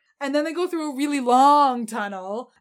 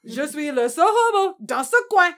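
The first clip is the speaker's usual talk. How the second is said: put-on French accent; 6 semitones higher, 7 dB louder than usual. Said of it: "And he's like".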